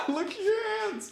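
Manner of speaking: laughing. Real, not acted. Laughing at her dirty hands.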